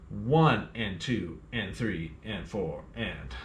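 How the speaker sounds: "one and two and three and four and" slow, long on the numbers, short on "and"; swung count. Shown as a demonstration. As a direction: swing the count, lilting. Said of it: "swing eighth notes ... felt differently".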